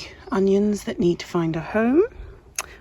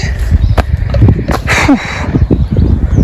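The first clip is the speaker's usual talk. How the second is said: heavy sigh; 4 semitones higher, 9 dB louder than usual. Real, not acted. Relieved after weeding carrot bed.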